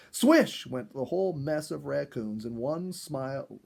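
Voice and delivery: ordinary delivery, nothing marked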